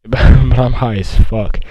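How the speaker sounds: slow, drawn-out repeat after a laugh; amused emphasis. Real, not acted.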